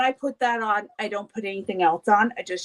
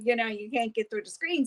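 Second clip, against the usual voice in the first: ordinary talk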